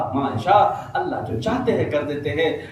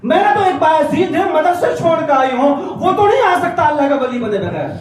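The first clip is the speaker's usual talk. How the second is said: sharp mother; 5 dB louder than usual. Acted. Mother refusing her son through the door, sharp and loud. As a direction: reject him sharply, not my son. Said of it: "بڑے تیکھے لہجے میں آواز آئی".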